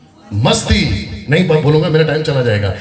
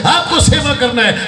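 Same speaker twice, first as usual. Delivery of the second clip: fiery preacher; 7 semitones higher than usual. Retold or imitated. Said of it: "fire में बोलते रहते हैं".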